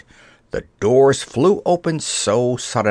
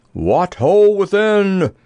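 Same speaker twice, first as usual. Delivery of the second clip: loud, high, long-drawn knightly hail; lusty roar; 8 semitones higher, 5 dB louder, slower than usual. A narrator's reading. Sir Hokus at the castle doors, roaring lustily. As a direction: call out lustily, stretching each word. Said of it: "roared the good knight lustily".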